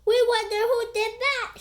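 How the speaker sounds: high, loud crowd chant; fans shouting together. Acted. Fans in the bleachers shout together, high and loud. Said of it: "shouted the fans".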